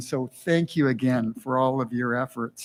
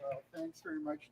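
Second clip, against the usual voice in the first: normal talking voice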